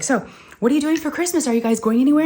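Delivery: high, over-bright small talk; retold distracting chatter. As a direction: play a doctor distracting a child with chat. Doctor chatting to distract crying child.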